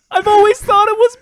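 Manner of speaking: very high, laughing mimicry; squeaky imitation. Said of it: "that's just an exclamation for you".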